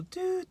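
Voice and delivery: high sustained beep noise; imitated censor beep. Shown as a demonstration. Showing a cool cover for cursing, imitated beep. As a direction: voice a long high bleep mid-sentence. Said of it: "to cover when I".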